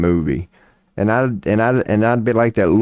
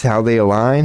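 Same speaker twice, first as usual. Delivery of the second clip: ordinary delivery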